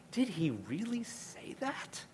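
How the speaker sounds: high, slow, hushed disbelief; incredulous. Shown as a demonstration. Preacher shows doubting tone, high hushed incredulity. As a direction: lean in with sly disbelief. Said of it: "Just the tone of voice begins to intimate".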